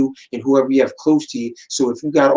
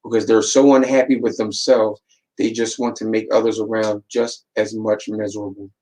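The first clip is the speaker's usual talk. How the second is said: ordinary talk, nothing marked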